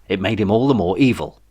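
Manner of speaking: ordinary delivery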